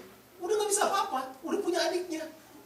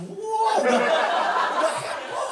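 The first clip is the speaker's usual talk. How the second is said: laughing excitedly; 8 dB louder, much slower than usual. Real, not acted. Crowd crying at the wedding.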